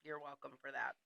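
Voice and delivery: talking while chewing ice; words around crunching ice. Real, not acted. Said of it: "If you hear me crunching my ice".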